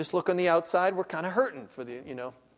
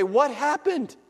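alarmed question; 9 semitones higher, 6 dB louder, slower than usual. Pretend. Husband to injured wife.

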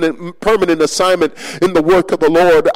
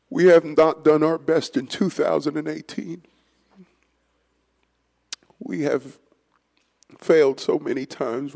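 low, quiet intercessory prayer; hushed pastoral prayer; soft prayer; 3 semitones lower, 9 dB quieter than usual. Acted. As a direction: pray softly on behalf of the people. Leads congregational prayer.

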